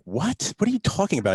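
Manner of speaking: high, incredulous what; mock baffled protest; retold disbelief. Retelling the cop stop, high incredulous protest. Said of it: "And I'm like".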